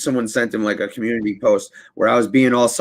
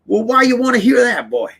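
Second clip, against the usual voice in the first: much higher, louder cartoon rooster; Foghorn Leghorn; 12 semitones higher, 5 dB louder than usual. Grandpa questioning grandson, hammed-up Foghorn Leghorn. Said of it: "He's like".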